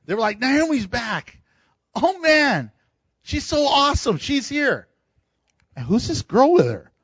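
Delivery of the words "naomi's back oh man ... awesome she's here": mock excited chatter, higher pitched; excited townsfolk. Townspeople greeting Naomi's return, lightly excited. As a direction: gush like a thrilled neighbour. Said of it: "everyone was excited".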